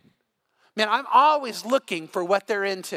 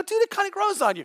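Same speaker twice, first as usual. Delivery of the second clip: put-on laid-back young self; 12 semitones higher than usual. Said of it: "I go".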